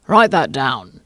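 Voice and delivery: low, loud royal command; King voice. A narrator's reading. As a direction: bark an order at the jury. The King orders the jury.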